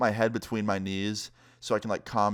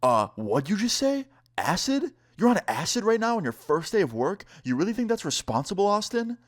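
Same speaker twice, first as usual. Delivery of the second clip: mock disbelief, pitch climbing much higher; shocked boss; 10 semitones higher than usual. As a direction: imitate the boss's stunned outrage. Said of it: "and he goes".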